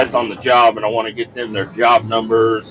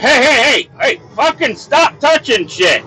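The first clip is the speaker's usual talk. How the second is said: alarmed shout; 11 semitones higher than usual. Retold or imitated. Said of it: "I'm like".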